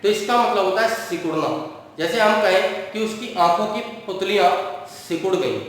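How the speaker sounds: ordinary delivery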